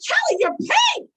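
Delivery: very high, loud, drawn-out disbelief; mock squeal of horror, stretched slow; retold shock. Jerry seeing Kelly's made-up face, shocked high squeal.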